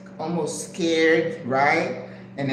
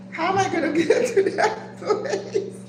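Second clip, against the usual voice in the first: high-pitched question breaking into laughter; laughing exasperation. Retold or imitated. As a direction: crack up while acting out the panic. Retells panic while cracking up.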